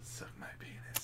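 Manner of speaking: sneaky whispered punchline. Real, not acted. Co-host decodes the rude comment name, whispering. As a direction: whisper the dirty words so nobody hears.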